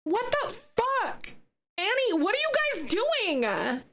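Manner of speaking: high, stretched shock at the gift; stunned disbelief. Real, not acted. Streamer reacts to Annie's gifted subs, stunned and high. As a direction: gasp it out in amazed disbelief.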